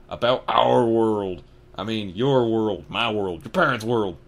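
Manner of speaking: slow, loud deliberate Hagrid; slow giant voice. Acted. Hagrid telling Harry about their world, slow and loud. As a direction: spell it out patiently but firmly.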